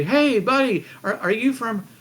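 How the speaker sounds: high, urgent rescuer voice; eager rescuer. Acted. Rescuer rushing to the exhausted arrival, high and urgent. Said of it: "they run over to you and say".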